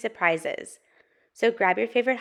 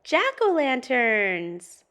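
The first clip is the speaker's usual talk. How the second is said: slow, stretched-out delight; delighted Pete; 4 semitones higher, 4 dB louder, much slower than usual. Acted. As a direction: start high and slide down slowly. Pete is thrilled in story.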